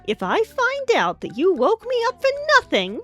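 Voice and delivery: high, loud indignant outburst; worked-up, high scolding; indignant threat. Acted. James threatens Thomas for waking him.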